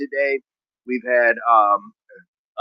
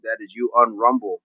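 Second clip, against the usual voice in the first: ordinary talk, nothing marked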